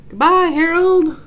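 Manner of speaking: high, loud, slow farewell; cried out. A narrator's reading. Santa leaving up the chimney, loud farewell. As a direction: call it out fondly. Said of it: "cried Santa".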